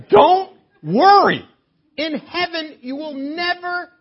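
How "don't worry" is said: emphatic quote. Retold or imitated. Repeats the phrase louder.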